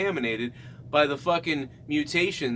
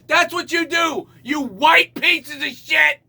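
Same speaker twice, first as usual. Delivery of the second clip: high, loud, slow shout; angry yell; 9 semitones higher, 4 dB louder, slower than usual. Real, not acted. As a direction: yell it at them.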